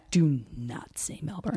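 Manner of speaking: imitating an Australian correcting you, quiet and slow; lightly done Aussie scolding; Australian accent. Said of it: "everyone's like".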